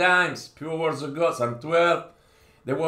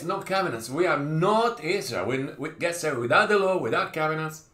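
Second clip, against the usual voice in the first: ordinary delivery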